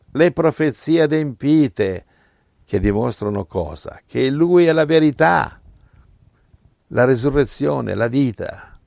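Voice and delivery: fiery preaching. Acted. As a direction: build to a proclamation. Preaching climax. (predica infuocata, più alta e forte)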